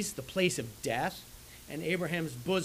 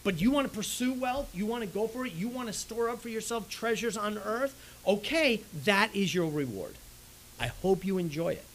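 sarcastic challenge; 6 semitones higher, 3 dB louder than usual. Acted. Preacher mocks the wealth seeker.

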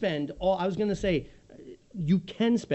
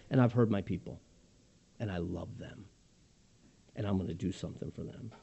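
much softer and lower, gentle and intimate; tender voice of God; 11 semitones lower, 8 dB quieter, faster than usual. Acted. Voicing God to his people, soft tender whisper-like promise. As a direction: speak God's promise like a hushed loving vow. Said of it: "go on in this passage to say".

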